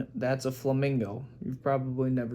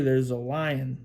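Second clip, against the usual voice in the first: ordinary delivery, nothing marked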